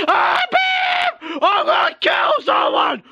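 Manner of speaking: high, furious yell; furious shout. Acted. Toy character raging after an attack, high furious yell. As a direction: scream it in rage.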